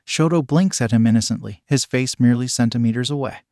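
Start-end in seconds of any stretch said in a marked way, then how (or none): none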